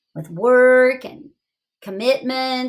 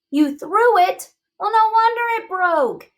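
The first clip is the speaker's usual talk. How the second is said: disbelief; 10 semitones higher than usual. Acted. Teacher scolding the boy in the poem, disbelieving. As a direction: lean on threw in disbelief. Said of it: "teacher reacts to the poem".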